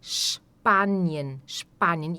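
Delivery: "Spanien" with a wide open A, "Shpaa-nien". Shown as a demonstration. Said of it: "o A sempre vai ser bem aberto".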